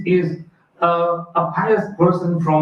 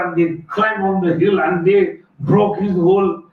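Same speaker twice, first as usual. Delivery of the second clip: usual speaking voice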